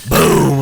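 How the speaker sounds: high, loud drawn-out "boom"; loud crash imitation. Telling how the plank hit the ground, loud imitated crash.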